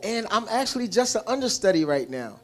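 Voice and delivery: ordinary talk, nothing marked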